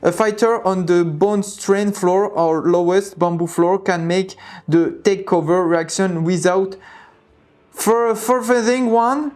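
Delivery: slow, stumbling English in a French accent; thick French accent. Real, not acted. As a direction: sound out hard English words with a French accent. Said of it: "j'ai vraiment un accent de merde".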